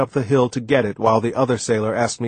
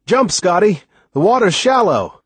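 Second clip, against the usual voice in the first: raised, high-pitched shout; yelled; 7 semitones higher, 4 dB louder than usual. A narrator's reading. Sailor tells Scotty to jump from the boat, yelling. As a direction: yell an order from the boat. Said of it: "Someone yelled".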